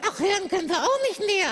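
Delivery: ordinary talk, nothing marked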